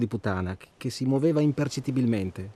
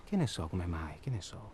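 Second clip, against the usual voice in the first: quiet evasion; 7 semitones lower, 8 dB quieter than usual. Acted. Deflating, evasive.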